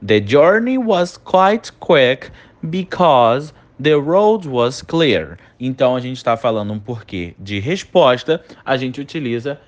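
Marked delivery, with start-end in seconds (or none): 0.1-5.4 slow dictation. Shown as a demonstration. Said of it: "Olha a nossa próxima frase".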